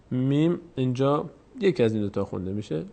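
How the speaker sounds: ordinary talk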